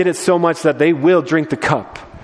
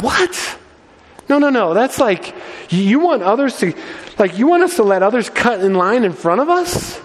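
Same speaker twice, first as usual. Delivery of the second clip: whining disciples; 7 semitones higher than usual. Retold or imitated. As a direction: complain like spoiled kids. Disciples voiced, shocked at servanthood.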